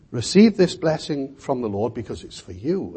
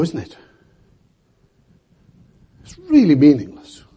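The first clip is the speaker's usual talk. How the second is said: ordinary talk, nothing marked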